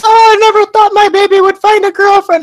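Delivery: high, loud motherly squeal; doting mom's shrieking joy; gushing mother. Acted. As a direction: gush it high, overcome with delight. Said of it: "and says".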